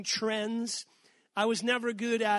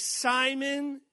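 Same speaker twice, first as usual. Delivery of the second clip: first call, louder and higher; louder first call of the name; calling Simon; 5 semitones higher, 5 dB louder than usual. Shown as a demonstration. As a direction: call his name out firmly. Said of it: "and then he repeats it".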